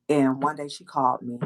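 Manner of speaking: normal talking voice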